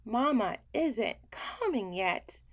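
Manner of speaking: plain reading voice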